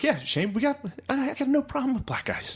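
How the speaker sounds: very high put-on dad voice; imitating small-town dad. Dad reassuring Shane, squeaky mock voice. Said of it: "they're like".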